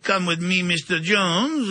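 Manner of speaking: put-on light French accent, drawn-out ending; imitated French accent. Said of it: "the guy had a French accent".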